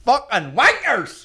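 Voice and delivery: high, loud, drawn-out mock yell; outburst impression. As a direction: imitate a vlogger's sweary outburst. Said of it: "Is that how he talks?".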